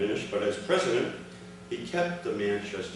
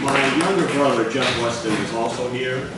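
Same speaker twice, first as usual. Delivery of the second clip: calling out to the room, much louder; loud, higher introduction of a family member; loud announcement; 3 semitones higher, 10 dB louder than usual. Real, not acted. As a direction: announce him over the crowd. Announcing brother to the audience.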